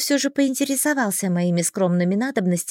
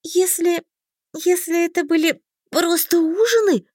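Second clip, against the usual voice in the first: high, halting, with a restart; nervous gulp; 8 semitones higher, 3 dB louder than usual. A narrator's reading. Heroine dreads the big reception, nervously. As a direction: stammer it out, pitched up and appalled. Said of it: "сглотнула я".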